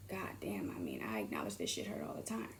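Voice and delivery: whisper. Real, not acted. Confessional aside about own pain.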